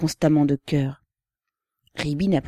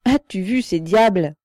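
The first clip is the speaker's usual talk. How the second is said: s'écrier, la voix plus forte; exclamation; 3 semitones higher, 6 dB louder than usual. A narrator's reading. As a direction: question lancée plus fort. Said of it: "Pavel s'écria". (en s'écriant)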